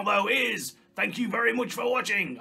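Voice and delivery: ordinary talk, nothing marked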